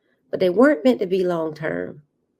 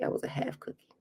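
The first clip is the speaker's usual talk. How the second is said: hushed whisper, much softer; whispered admission; 13 dB quieter, faster than usual. Real, not acted. Admitting to listeners she was only half a cookie, whispered. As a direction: whisper it like a confession.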